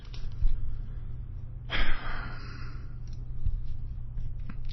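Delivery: long, soft exhale; weary sigh. Real, not acted. Broadcaster tired of things failing, long weary sigh. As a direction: sigh in real resignation.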